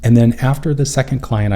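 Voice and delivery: ordinary talk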